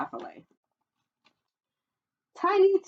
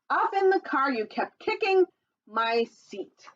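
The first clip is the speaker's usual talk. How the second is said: lively sing-song storybook read, higher; reading to children; 8 semitones higher, 4 dB louder than usual. Acted. Reading a dinosaur picture book to children, sing-song storytelling voice. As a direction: read the rhyme brightly to little listeners.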